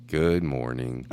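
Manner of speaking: low, slow, soft depressed mumble; gloomy. Retold or imitated. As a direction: greet like Eeyore, dragging. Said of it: "And I'm like".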